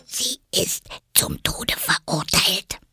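slow, secretive whisper. Acted. Rabbit tells Alice a secret, whispering. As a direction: whisper it close into an ear. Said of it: "und wisperte".

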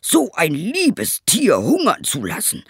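high, indignant outburst; outraged cry. Acted. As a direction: rage on behalf of the goat. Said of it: "schrie der Schneider".

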